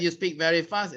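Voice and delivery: quick, run-together demo; very fast. Shown as a demonstration. Trainer showing the class speaking rate, fast. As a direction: rattle it off fast. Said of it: "Rate refers to your speaking rate".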